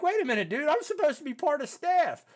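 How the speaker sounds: high, loud panicked protest; retold freaking out. Graduate expecting to be staff, freaking out at boot camp treatment. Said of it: "freaking out".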